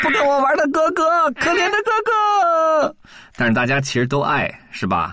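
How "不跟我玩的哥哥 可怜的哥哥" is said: put-on high, tearful little-girl call; small sister calling her lost brother, a touch tearful. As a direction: cry out for her brother, voice near tears. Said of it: "娜娜也跟大家一起喊".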